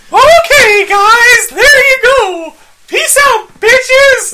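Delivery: squeaky, very high and loud; Mickey Mouse voice. Pretend. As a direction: go way up into a squeaky cartoon voice. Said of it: "I think I sounded like Mickey Mouse".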